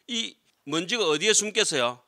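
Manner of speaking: usual speaking voice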